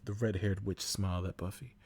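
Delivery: ordinary delivery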